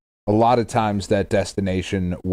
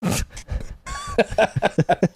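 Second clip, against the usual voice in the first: high-pitched giggle; a real high giggle; 13 semitones higher than usual. Real, not acted. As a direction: giggle up high at your own joke. Laughing at own joke.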